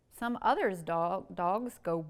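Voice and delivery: slow, sing-song picture-book reading; reading to children. Acted. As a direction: read it out slowly for little kids.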